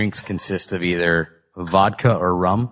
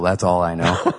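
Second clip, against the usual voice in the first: ordinary delivery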